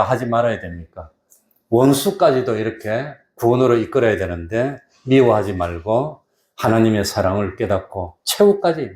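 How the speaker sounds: ordinary talk, nothing marked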